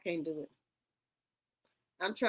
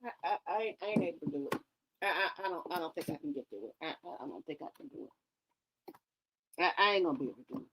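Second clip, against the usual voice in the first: softer, rushed, breaking down helplessly; breathless giving up; 6 semitones lower, 5 dB quieter, much faster than usual. Real, not acted. Overcome by the interview, she breathlessly gives up. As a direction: fall apart mid-sentence, quicker and quieter. Collapsing from laughter.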